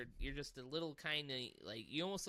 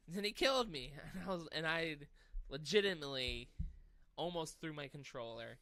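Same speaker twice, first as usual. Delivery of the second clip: ordinary talk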